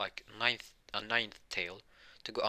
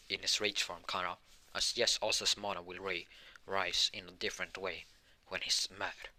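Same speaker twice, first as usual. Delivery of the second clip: ordinary delivery, nothing marked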